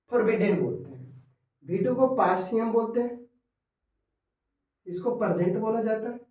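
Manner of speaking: normal talking voice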